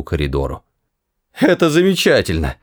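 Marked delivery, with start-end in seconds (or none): 1.3-2.6 high, strained mock praise; wheezing. A narrator's reading. Arthur held by the neck, lightly strained wheeze. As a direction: squeeze it out as if half-choked. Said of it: "хрипел Артур".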